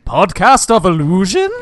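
"illusion" stretched and rising like a question; loud, high tryout with "illusion" lifted at the end; questioning rehearsal take. Acted. Trying the title in the mirror, "illusion" rising doubtfully. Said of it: "rehearsing how they're gonna talk".

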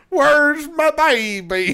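slow laughing Scottish pirate line; mock Scottish pirate. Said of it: "This is like a Scottish pirate".

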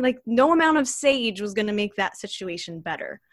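usual speaking voice

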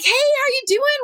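high, bright mock-excited hello; cheery high greeting. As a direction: greet him like an overjoyed old friend. Retold cheery greeting to student.

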